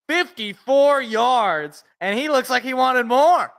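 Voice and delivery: mock broadcaster calling the play, building up. Streamer doing play-by-play of the Lions game, announcer voice. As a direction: call it like a TV announcer, rising with the run.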